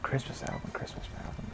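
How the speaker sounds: mock whispered thinking to himself; hushed muttering. [name] realizing he must sing, whispering to himself. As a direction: mutter it very softly, working it out.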